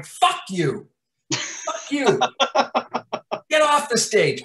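mock furious yell, pitch jumping up; half-acted shouting across a table; imitating yelling at an actor to get off the stage; retold yell. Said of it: "I am on my feet across the table going".

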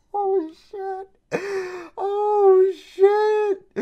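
high, loud cry of shock; stunned high-pitched outcry; shocked shriek. Real, not acted. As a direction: yelp it out high in disbelief. Shocked at the demon scene.

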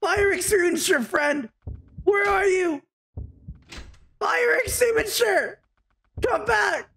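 wailing after a departed buddy, playfully overdone; mock-desperate calling. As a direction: call out for a lost friend, hammed-up. Calling after lost fire extinguisher.

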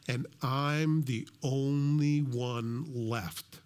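stretched out, word by laboured word; self-pitying complaint. Acted. Elijah complaining to God he is alone, slow lament. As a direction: drag every word out for effect.

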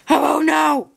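loud, high shouted scare. Pretend. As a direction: bellow it to startle. Tries scaring again.

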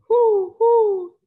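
high, loud hooting owl call. Acted. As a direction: hoot the words like an owl to the kids. Owl hoots in picture book.

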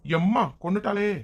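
high, hammed-up pained wailing; acted pain. Husband complaining that wife is killing him, hammed-up pained cry. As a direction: wail as if badly hurt. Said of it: "விடு டீ வலிக்கு".

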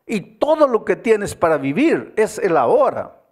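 loud, high, urgent preaching; impassioned preaching. Acted. Pastor urging the congregation, loud and high. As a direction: drive the point home from the pulpit.

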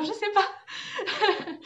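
breaking into high laughter; laughing. Real, not acted. Vlogger tripping over foreign names in her video, laughing. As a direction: give up and laugh, pitch shooting up.

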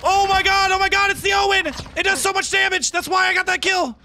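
high-pitched excited shouting; real fanboy outburst, very high; thrilled yelling. Real, not acted. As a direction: yell it out, amazed by the gun. Thrilled by the new gun.

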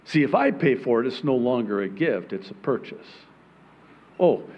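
ordinary talk, nothing marked

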